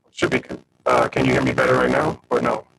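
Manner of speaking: deep, distorted scrambled voice; garbled audio. Real, not acted. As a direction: sound like a broken mic, garbled and low. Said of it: "it's still really garbled".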